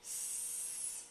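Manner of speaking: S as a drawn-out, voiceless sss; long sound. Shown as a demonstration. Clinician sounding out the start of sit, S held as a long sss. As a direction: stretch S into a long, quiet sss. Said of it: "has a long sound".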